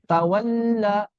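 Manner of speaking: 'tawalla' with the l flowing on freely; rakhawah lam. Shown as a demonstration. Teacher demonstrates lam read as rakhawah, flowing sound. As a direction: let the l of 'tawalla' run long. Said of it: "Lam dibaca roqah begini".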